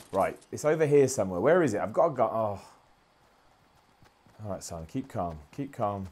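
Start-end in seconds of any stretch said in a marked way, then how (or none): 4.4-6.1 hushed self-calming. Real, not acted. Calming himself mid-fight.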